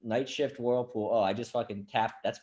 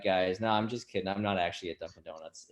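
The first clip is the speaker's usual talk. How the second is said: ordinary talk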